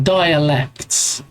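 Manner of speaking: "dialects" drawn out slowly, every syllable separate; careful pronunciation. Shown as a demonstration. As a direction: say "dialects" very slowly and distinctly, not like Daleks. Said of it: "sounds a bit like Daleks, but".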